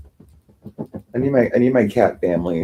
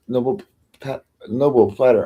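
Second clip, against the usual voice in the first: usual speaking voice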